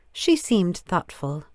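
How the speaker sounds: plain reading voice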